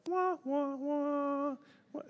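high muted-trombone wah sounds; mock dejected wah, wah, wah; sad Charlie Brown wah-wah. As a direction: imitate a cartoon sad-exit horn. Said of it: "like, Charlie Brown".

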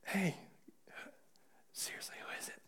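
urgent unvoiced whisper; a disciple pressing Jesus for the name, whispering. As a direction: hiss it so no one at the table hears. John presses Jesus in secret.

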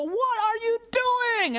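high, drawn-out cry of outrage; upset outburst. Acted. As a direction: wail it slowly at the friend who wrecked your car. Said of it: "obviously you're upset".

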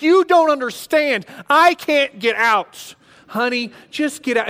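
stuck and scared, pleading with wife; terrified refusal. Retold or imitated. Frozen with fear, refusing wife.

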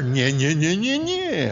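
loud mocking comeback; mocking retort. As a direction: throw the taunt straight back at her. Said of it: "I say to her".

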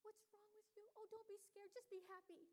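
high, gushing, anxious mother; smothering mom. Retold or imitated. Smothering mother fusses over child waking from a bad dream, high. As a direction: fuss over the child far too much. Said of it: "the mother grabs her and says".

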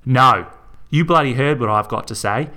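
a loud barked no, then hard words; angry shout. Acted. Melinda shouting Garth down before throwing him out, loud. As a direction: shut him up at full volume.

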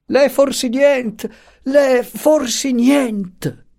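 very high, drawn-out calling; long frantic high cry; shouting after her. A narrator's reading. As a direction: call after someone running away. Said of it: "gridava dietro".

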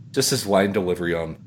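normal talking voice

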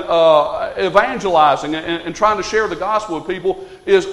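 normal talking voice

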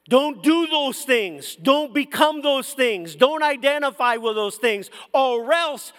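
preaching at full force. Acted. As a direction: preach it out to the church with fire. Preacher builds to warning climax.

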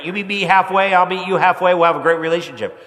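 normal talking voice

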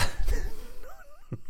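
high squeaky burst of laughter; laughing at himself. Real, not acted. After botching the accent, a high laugh at himself. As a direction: crack up at the failed accent. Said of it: "No, I can't".

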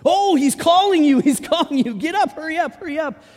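high, loud eager urging; excited crowd calling out. Retold or imitated. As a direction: hurry him along, suddenly all encouraging. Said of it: "What did they do?".

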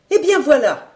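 high, emphatic cook about to tell all; gossip's announcement. A narrator's reading. Cook beginning the account for the journalist, self-important gossip. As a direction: launch the tale with a high flourish. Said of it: "posa la commère".

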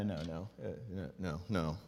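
ordinary talk

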